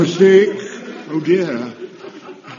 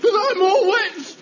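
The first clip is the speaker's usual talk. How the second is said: whining; 14 semitones higher than usual. Acted. Soaked boy complains, cry heard.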